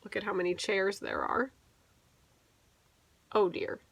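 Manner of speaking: usual speaking voice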